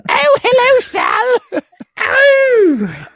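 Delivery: a mocking high squeaky voice; high-pitched voice. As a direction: greet him in a silly high falsetto. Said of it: "we should do the whole show like that".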